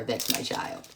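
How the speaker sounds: whispered, soft and low; whispered aside. Real, not acted. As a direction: whisper it as a fond aside to viewers. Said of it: "I can talk like that to her".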